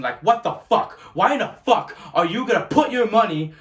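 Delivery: building to a loud, furious outburst; angry rant. Real, not acted. Fan ranting at the Red Sox owners, furious.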